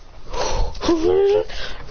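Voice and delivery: sharp intake of breath; shocked gasp. Acted. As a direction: gasp in surprise.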